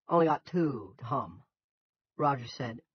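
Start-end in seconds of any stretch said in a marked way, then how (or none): 0.1-1.4 slow, loud Yankee farmer; thick Yankee accent. A narrator's reading. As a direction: drawl it in thick Yankee, higher-pitched. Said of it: "In his thick Yankee accent".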